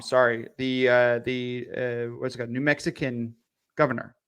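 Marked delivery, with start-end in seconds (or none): none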